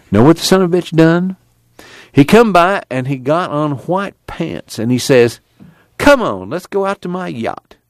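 hint of a bitter country man; aggrieved one-eyed man. One-eyed man airs his grievance.

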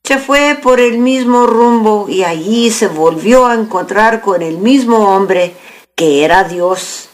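ordinary delivery

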